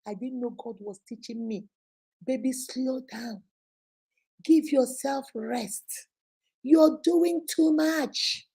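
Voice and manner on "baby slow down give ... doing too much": tender God voice. Acted. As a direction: soothe her like a loving father. God speaking tenderly to her.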